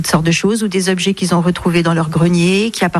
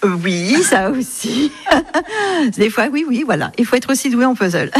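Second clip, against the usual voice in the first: amused, laughing; 7 semitones higher than usual. Real, not acted. Restorer admits to interviewer she needs puzzle skills, amused, laughing. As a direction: admit it through little bursts of laughter.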